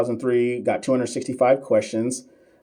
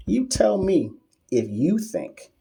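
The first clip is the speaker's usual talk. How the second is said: higher, slow, put-on challenge voice; mock drawn-out challenge; 8 semitones higher, slower than usual. Shown as a demonstration. Streamer throws the question back at a trolling doctor, slow mock challenge. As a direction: stretch each word to throw it back. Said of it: "repeat said slower".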